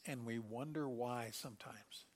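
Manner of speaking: hushed unvoiced wonder; whisper. Real, not acted. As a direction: drop to a whisper, musing. Quiet musing aside in sermon.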